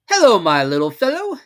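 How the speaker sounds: friendly owl voice, lifted and loud; kindly Owl. A narrator's reading. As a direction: greet the little one fondly. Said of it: "in a kindly way".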